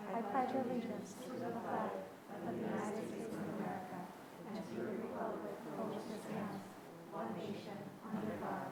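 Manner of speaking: group recitation in unison; reciting pledge. Real, not acted. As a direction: recite it together steadily.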